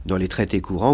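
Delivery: ordinary talk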